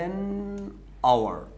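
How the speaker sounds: teacher modelling an hour; slow model phrase. Shown as a demonstration. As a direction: spell out آن اور slowly for the class. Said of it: "تشتغل على ال او".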